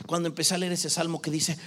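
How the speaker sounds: ordinary talk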